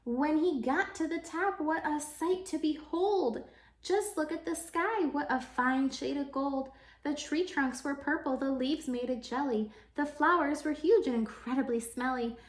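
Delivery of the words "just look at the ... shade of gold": ordinary delivery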